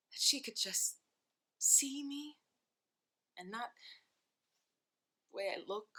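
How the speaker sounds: soft, halting, wounded admission; hurt confession. Acted. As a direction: confess it quietly, with long pauses. Confessing hurt about her mother.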